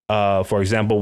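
ordinary talk

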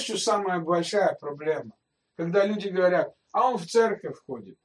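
ordinary talk

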